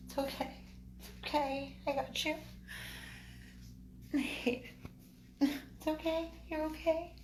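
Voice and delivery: high, soft reassurance; soothing. Real, not acted. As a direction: comfort a frightened puppy in a small high voice. Calming puppy after painful swab.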